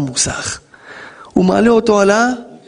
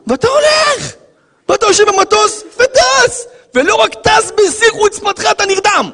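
preaching at full force. Real, not acted. Preacher shouting to the congregation at full force. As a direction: shout it, pitched far higher.

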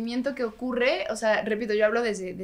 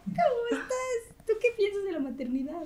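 put-on cute baby talk; 7 semitones higher, 9 dB quieter than usual.

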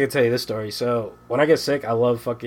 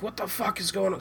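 retold anger; 7 semitones higher, 9 dB quieter than usual. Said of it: "I'm like".

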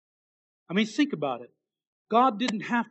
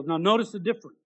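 ordinary delivery

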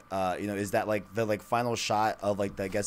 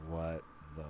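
low, soft disbelief; stunned hush; 5 semitones lower, 13 dB quieter than usual. Real, not acted. Co-host stunned by the game idea, hushed disbelief. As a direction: say it softly, stunned.

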